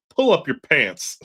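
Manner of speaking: a hint of a gruff Dennis Leary; Dennis Leary impression. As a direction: bark it like Dennis Leary, lightly. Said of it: "say it like Dennis Leary".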